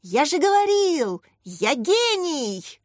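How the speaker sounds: high, drawn-out boast; triumphant shout. A narrator's reading. Boy whose team is winning, triumphant shout. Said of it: "кричал Думцев".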